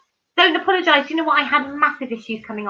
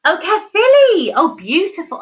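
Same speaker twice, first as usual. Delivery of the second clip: excited; 8 semitones higher, 8 dB louder than usual. Real, not acted. Viewer names Welsh town.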